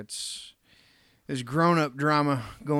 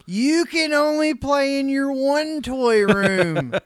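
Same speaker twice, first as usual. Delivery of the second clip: mock strict parent; 8 semitones higher, 5 dB louder, slower than usual. Mocking a rich kid's punishment.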